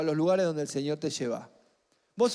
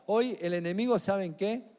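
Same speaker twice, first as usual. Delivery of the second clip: ordinary talk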